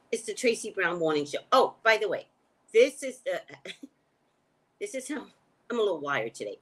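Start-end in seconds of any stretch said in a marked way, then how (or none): none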